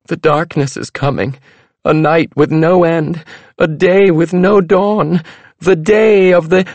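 high, loud rising prophecy; fortune teller. A narrator's reading. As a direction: build to a doom-filled climax.

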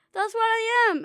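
ordinary delivery, nothing marked

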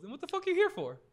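high-pitched mock disbelief; retold incredulous question. Retold challenge to his presence.

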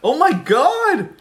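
high, slow, drawn-out delight. Real, not acted. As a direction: swoon over the taste. First sip of the beer.

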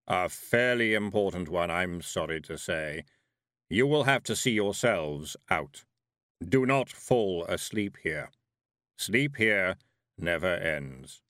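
slow, heavy voice of Death; heavy thundering voice. A narrator's reading. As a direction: let it rumble like distant thunder. Death warns Tiffany.

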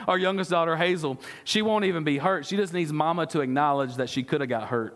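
ordinary delivery

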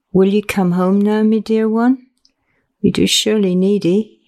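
usual speaking voice

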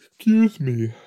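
talking through a yawn. Real, not acted. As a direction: excuse yourself mid-yawn. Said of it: "I'm yawning".

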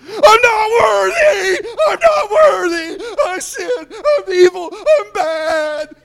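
hammed-up high, drawn-out wail; mock-cry it loudly, far above your normal pitch; wailing self-blame. Acts out believer beating himself up.

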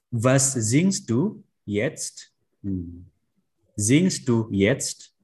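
Was singst du jetzt read very slowly, with a rising question at the end; slow model question. Shown as a demonstration. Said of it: "reading example questions".

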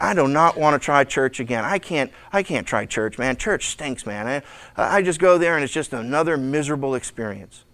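low, soft, dejected grumble; mock weariness. Burned churchgoer giving up on church, mock dejected. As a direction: drop low and soft, imitating someone fed up. Mimics person fed up with church.

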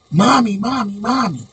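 put-on whiny kids calling for mom; kids screaming mommy. Kids barging in on mom, calling out in high whiny voices. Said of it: "and scream".

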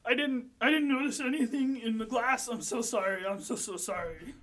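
a tipsy bartender begging forgiveness; apologetic drunk Tony. Acted. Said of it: "he says".

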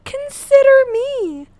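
high pleading fangirl voice; begging fangirl. Retold or imitated. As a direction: plead like a lovesick fan. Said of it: "signs that was like".

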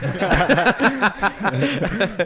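big burst of laughter; loud laugh. Real, not acted. Cohost laughing hard at the shoe box name.

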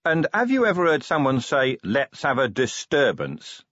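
a hint of an incredulous police chief questioning his butler; gruff commander in conversation. Voices Vimes in story.

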